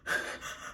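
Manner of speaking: quick, voiceless panicked gasps; imitated hyperventilating. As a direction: imitate struggling for air, no words. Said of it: "having an anxiety panic attack".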